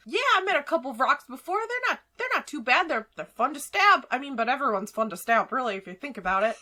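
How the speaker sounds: high, fast chattering character voice; Gemini. Acted. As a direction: rattle it off high and quick, as a chatty dagger. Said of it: "And he says".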